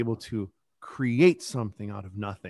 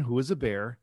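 ordinary talk

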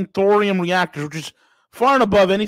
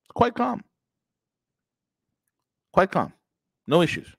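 mock calm Russians; 6 semitones lower, 4 dB quieter than usual. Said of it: "And the Russians like".

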